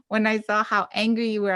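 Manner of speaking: ordinary talk, nothing marked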